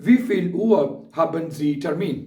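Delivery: very slow, every German word spelled out for learners; slow dictation. Shown as a demonstration. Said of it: "ये question पूछा जा सकता है".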